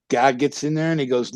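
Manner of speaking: usual speaking voice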